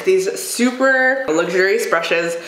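ordinary talk

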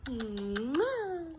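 high, drawn-out "mwah"; big kiss sound. Real, not acted. Mother blows a goodbye kiss.

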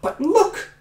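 high, loud squeaky rat; rat cried. Acted. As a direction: squeak it out excitedly. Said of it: "cried the rat".

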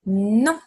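Non with a nasal o, called out loud; teacher calling out Non for pupils to echo; French non, loud. Shown as a demonstration. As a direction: model Non firmly for the class to repeat. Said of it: "can you say non".

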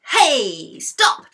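loud, high scolding shout; storybook cross lady yelling. A narrator's reading. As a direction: shout to stop them, indignant. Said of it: "shouted a cross-looking lady".

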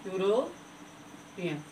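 very slow, soft "European" stretched syllable by syllable; drawn-out word. Shown as a demonstration. Teacher slowly modeling the word for students. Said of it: "ई से हमने लिखा European".

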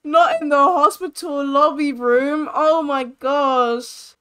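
dismayed scolding. Real, not acted. Player appalled at her Sim in the hospital lobby, dismayed. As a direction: wail it, dragging out the last words.

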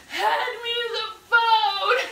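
high, slow, drawn-out crying demand; hammed-up weeping, stretching each word; mock tearful wail. Daughter imitates mom face down on floor, wailing. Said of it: "and she's like".

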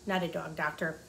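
usual speaking voice

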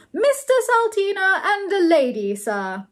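high, loud announcement; shouting. A narrator's reading. Butler announces the guests, formal shout. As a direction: proclaim the guests at high pitch.

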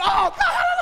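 loud high retold shout of shock; panicked cry. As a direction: yell it like you're caught up running. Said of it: "We were like".

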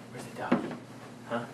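hushed playful ask; whispering. Real, not acted. Whispered question to toddler.

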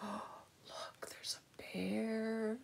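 gasp, then whisper slowly; soft, whispered discovery; hushed wonder. Real, not acted. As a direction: point it out in an awed whisper.

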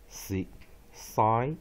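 "Si-，si-，bi-ri-e" very slowly, a gap after each syllable. Shown as a demonstration. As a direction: break "Si-，si-，bi-ri-e" into separate drawn-out sounds. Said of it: "所以我们再来看一遍".

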